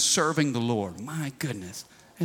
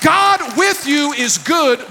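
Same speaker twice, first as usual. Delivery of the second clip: higher, louder and slow; preaching emphasis; 9 semitones higher, 5 dB louder, slower than usual. Acted. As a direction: lean hard into each word. Preaching climax.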